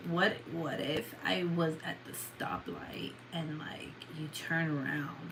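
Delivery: slow, soft, breathy whisper; meme whisper. Retold or imitated. Quoting a TikTok meme to viewers, slow whisper. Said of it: "What is that one meme?".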